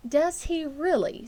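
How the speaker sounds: a hint of a worried mother humoring; vague mother. A narrator's reading. Mother replying vaguely to Fern, slowly.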